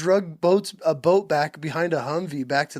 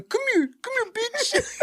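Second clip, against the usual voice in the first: much higher, laughing put-on sergeant voice; sergeant impression. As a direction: taunt like the sergeant, pitched way up. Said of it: "he would fucking".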